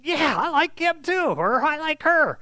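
high-pitched, gullible enthusiasm; mock their easy acceptance in a high voice; eager converts. Voices eager converts to new gods.

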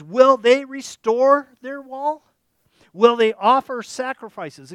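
slow, loud scornful questions; mocking questions. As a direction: jeer as if the idea is laughable. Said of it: "And he says".

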